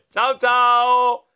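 loud, bright goodbye; cheery bunny goodbye. Acted. Bunny's final answer to the children, loud. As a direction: wave goodbye loudly. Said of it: "lui rispose".

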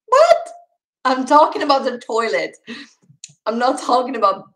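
high, loud incredulous cry; sudden disbelief, then insisting; retold shock at the French staff; shocked outburst.

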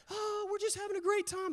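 high-pitched sing-song mimicry; mock a blissfully happy family, voice way up; high, airy mock delight; carefree family.

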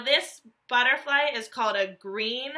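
normal talking voice

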